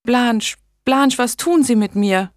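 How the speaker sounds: high, distressed plea, lightly acted; sobbing. A narrator's reading. Megan in labour begging Blanche for answers, mildly sobbing. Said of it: "Megan schluchzte".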